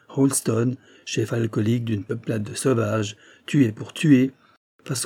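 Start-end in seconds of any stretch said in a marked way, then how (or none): none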